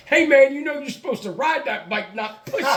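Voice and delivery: high-pitched taunting driver voice; mocking passerby. Driver mocking him for pushing his bike, taunting. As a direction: jeer at him from a passing car. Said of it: "some asshole drive by in a car goes".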